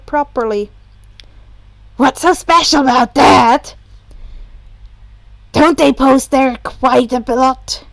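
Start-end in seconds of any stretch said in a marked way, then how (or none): 1.9-7.8 a lightly voiced, louder, curious Hitoshi; Hitoshi's voice. A narrator's reading. Hitoshi asking Shoto what else he knows, lightly voiced, louder.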